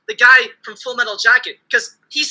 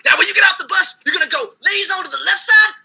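mock Marine sergeant shouting, higher; Marine sergeant yell; 6 semitones higher than usual. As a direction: bellow like a sergeant at the bus. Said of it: "I'll hear a Marine sergeant".

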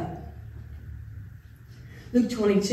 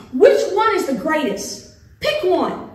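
louder and higher, pushing for an answer; challenging demand; 6 semitones higher, 5 dB louder than usual. Retold or imitated. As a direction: corner him: pick one.

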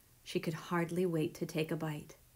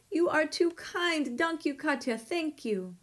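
acted weepy thanks, pitched up; sobbing; 9 semitones higher than usual. A narrator's reading. Neighbor thanking Katya while dabbing away tears, sobbing. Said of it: "sobbed Missus Deland".

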